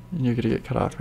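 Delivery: ordinary talk, nothing marked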